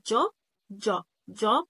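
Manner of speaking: جوب with an English j, as in "job"; J sound. Shown as a demonstration. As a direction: demonstrate جوب with the English "dzh" opening. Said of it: "بيبقى زي الجي بالظبط". (نطق جوب بجيم إنجليزية)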